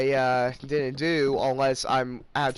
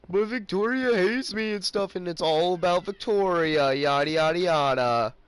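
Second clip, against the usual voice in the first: higher mocking whine; mocking whiny friend; 5 semitones higher, 4 dB louder than usual. Vlogger mocking Lester's complaints about Victoria, higher whine. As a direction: mimic his moaning, pitched up. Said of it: "'cause he's like".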